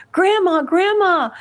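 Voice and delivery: high calling yell, each word falling; yelling across the yard. Retold or imitated. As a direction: call out across a prison yard. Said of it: "She would yell across the yard".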